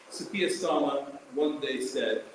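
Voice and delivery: normal talking voice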